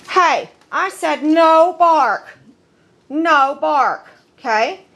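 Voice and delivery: stern command tone. Shown as a demonstration. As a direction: drop a stern warning on the dog. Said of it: "That's my tone of voice for that".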